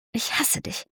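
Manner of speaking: soft, breathless whisper of hate. Acted. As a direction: barely voice it, close to his face. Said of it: "sagte Jess leise".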